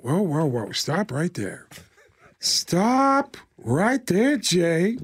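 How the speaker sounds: slow, halting impression swelling high; long pauses, stretched words, grand wonder; Al Pacino. Movie star showing his friend the night sky, theatrical awe. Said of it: "Al Pacino looked up in the sky and went".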